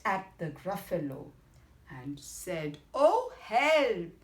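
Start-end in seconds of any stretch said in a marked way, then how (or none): 2.9-4.1 loud, raised cry for help; storybook yelp of alarm, pitched up; alarmed fox. Acted. Fox catching sight of the Gruffalo, alarmed.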